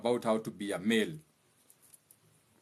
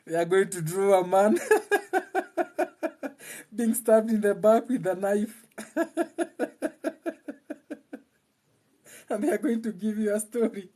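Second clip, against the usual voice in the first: laughing; 7 semitones higher than usual. Real, not acted. Mocking laugh-filled Bible retelling.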